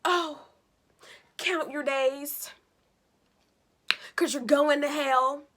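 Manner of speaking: oh's drawn out, then count your days spoken slowly; slow mock warning. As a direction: warn them like a preacher, slowly. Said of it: "I said".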